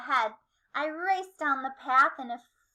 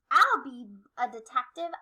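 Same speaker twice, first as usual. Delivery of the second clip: ordinary delivery, nothing marked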